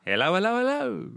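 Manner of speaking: high sing-song hello climbing up; Hari Sugiyama impression. Imitating the DJ's greeting, high bouncy sing-song. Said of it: "that's how he says hi".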